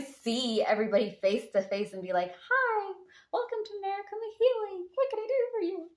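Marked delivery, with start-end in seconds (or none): 2.3-5.9 very high, bright customer-service voice; squeaky Mickey Mouse greeting; high excited greeting. Pretend. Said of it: "I don't sound like that".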